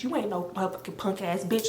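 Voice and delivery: steeling herself under her breath; muttered pep talk. Retold or imitated. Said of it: "I'm just like".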